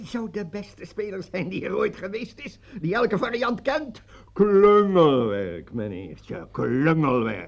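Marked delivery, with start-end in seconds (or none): 4.3-7.5 slow, contemptuous drawl on klungelwerk; scorning the opponent's sloppy play; scornful verdict. Acted. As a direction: sneer at the bungling move. Mocking the opponent's move.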